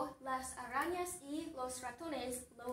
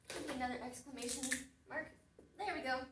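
ordinary delivery

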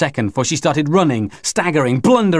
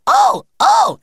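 loud, very high electronic-signal squawk; piercing alien squawk; metallic squawk; 5 dB louder than usual. Acted. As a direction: squawk like an alarm, not quite human. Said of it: "in a metallic squawk".

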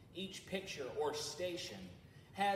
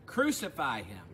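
a firm, raised crowd cry; the crowd answers Pilate with a shouted demand; crowd shouting; 2 semitones lower, 10 dB louder than usual. Acted. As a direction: call it out like the angry crowd. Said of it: "They shouted back".

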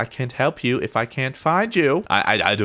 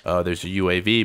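ordinary talk, nothing marked

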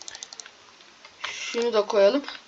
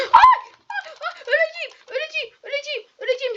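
high, rushed cries of dying; panicked wail; 3 dB louder than usual. Real, not acted. Gamer about to die in the game, high panicked wail.

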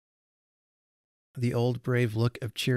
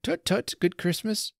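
high, chirpy tutting; bright, kindly chiding; cheery admonition; 10 semitones higher than usual. A narrator's reading. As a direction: scold an old friend cheerfully. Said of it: "in cheery admonition".

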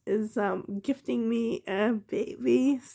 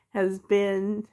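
ordinary talk

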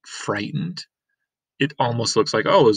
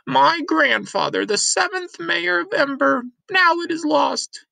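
much higher, distracted old woman; old Granny; 4 dB louder than usual. Granny rummaging and talking to Lena, higher old woman's voice. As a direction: mutter excitedly while rummaging.

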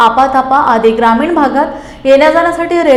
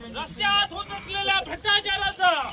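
battle cry; 7 semitones higher, 13 dB quieter than usual. Real, not acted. Protest leader calls out slogan.

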